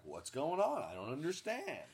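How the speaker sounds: lost puppy asking why; confused dog voice. Retold or imitated. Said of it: "he's looking at me like".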